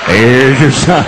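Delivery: loud low catchphrase hitting sign; punchline catchphrase. Acted. Comic caps the story, loud catchphrase. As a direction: slam the tag line.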